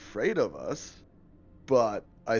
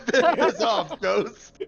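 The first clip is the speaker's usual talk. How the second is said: higher, louder laughing piss off; laughing jeer; 13 semitones higher, 8 dB louder, much slower than usual. Pretend. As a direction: laugh it out at the ghost.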